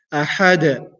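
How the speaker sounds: أحد with a bounced final dal; qalqala bounce. Shown as a demonstration. Teacher repeating the qalqala for students. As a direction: bounce the last letter of أحد. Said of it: "the bouncing".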